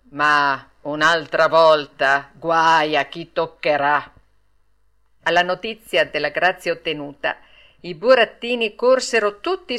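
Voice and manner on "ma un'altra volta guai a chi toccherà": low, loud, slow threat; stern warning. A narrator's reading. Puppet master warns the puppets about next time, low and stern. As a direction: warn them slowly and heavily.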